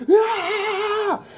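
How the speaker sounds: long high strained yell; mock scream of surging electricity; imitated power scream.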